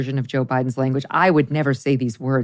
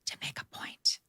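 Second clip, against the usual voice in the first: hushed, voiceless whisper; 17 dB quieter than usual. Acted. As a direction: whisper it to imitate the president. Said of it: "President Biden likes to whisper".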